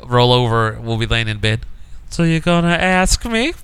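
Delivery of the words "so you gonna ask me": high, slow wheedling imitation; teasing girlfriend nag. Girlfriend comes round the corner, teasing proposal nag. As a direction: stretch it out, pitched up, lifting at the end. Girlfriend pesters him in bed.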